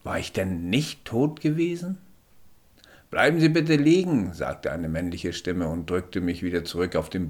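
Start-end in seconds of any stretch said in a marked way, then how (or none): 3.1-4.3 höher und lauter, bestimmter Sanitäter. Acted. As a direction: fordert den Patienten energisch auf liegen zu bleiben. Sanitäter spricht zum Erwachten. (Sanitäter beugt sich über den Patienten, höher und lauter)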